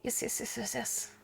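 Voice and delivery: rapid, breathless whisper of joy; a real excited whisper; whispered yeses. Real, not acted. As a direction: whisper it, giddy. Excited whisper over pancakes.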